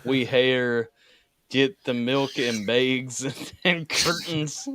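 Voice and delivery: slow, exaggerated Canadian accent; imitated Canadian accent. Teasing how the Canadian talks about milk bags, slow mock Canadian accent. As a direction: mimic a Canadian friend's vowels, slowly. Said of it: "He speaks like that".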